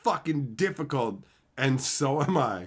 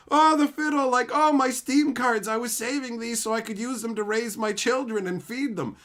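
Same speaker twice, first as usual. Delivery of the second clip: mock wailing; 6 semitones higher than usual. Mocking a pleading seller, hammed-up and wailing. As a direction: wail theatrically as if begging. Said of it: "I didn't say it like".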